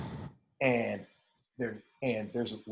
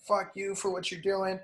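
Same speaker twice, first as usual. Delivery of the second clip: mock screaming; 7 semitones higher than usual. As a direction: imitate a protester screaming at cops, held back. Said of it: "start screaming at the cops".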